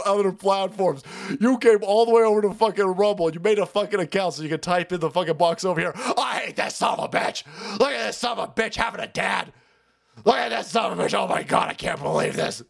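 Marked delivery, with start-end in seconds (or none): 6.0-12.6 mock angry viewer. Voicing angry viewers typing.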